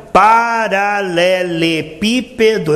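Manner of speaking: drawn-out, deliberate "paralelepípedo", each syllable held. Shown as a demonstration. Preacher showing he knows each next syllable, syllable by syllable "paralelepípedo". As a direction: stretch "paralelepípedo" very slowly, one syllable after another. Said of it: "eu sei a próxima sílaba".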